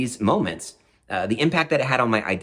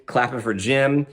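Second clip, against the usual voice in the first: usual speaking voice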